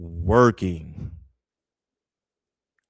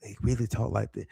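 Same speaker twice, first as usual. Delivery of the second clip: softly spoken imitation; talking very low; 6 dB quieter, faster than usual. Shown as a demonstration. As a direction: keep it small and quiet, a little quick. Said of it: "very low".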